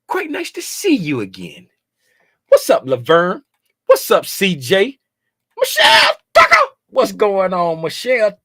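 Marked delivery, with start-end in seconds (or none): 5.6-6.6 high, loud call of the name; loud happy shout; excited shout. Real, not acted. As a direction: call her name out like spotting a friend. Calling out viewer name.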